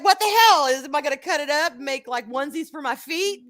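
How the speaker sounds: high-pitched comic indignation, breaking into a laugh; mock-outraged rant with a laugh near the end; exasperated outrage. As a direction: retell being pissed, pitched high and half laughing. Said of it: "I was so pissed".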